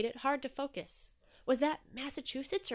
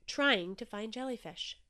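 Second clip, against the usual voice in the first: plain reading voice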